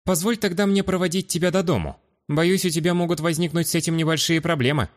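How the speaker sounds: high-pitched, gallant offer; courteous young hero. Acted. Young man offering to walk a noble girl home, courteous. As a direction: make a polite offer, pitched well up.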